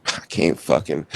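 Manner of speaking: low, soft, slow resignation; mock defeat. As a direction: act like a beaten-down husband. Acted husband defeated by wife.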